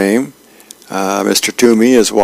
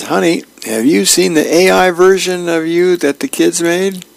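lightly voiced husband, higher and eager; cheerful husband; 8 semitones higher than usual. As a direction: ask it up high like a proud husband. Husband asks wife in comic.

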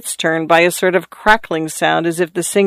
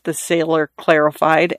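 plain reading voice

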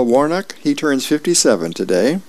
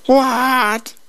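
high, startled mock outcry; cartoon mom's astonished yelp; acted shock. A narrator's reading. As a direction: react in comic disbelief, pitch leaping up. Mom reacts to shocking news.

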